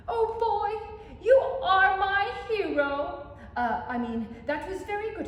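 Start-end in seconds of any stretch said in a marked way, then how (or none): 0.1-3.2 gushing delight. Acted. Teacher thanking Bobby for saving her hat, gushing. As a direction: swoon over your rescuer.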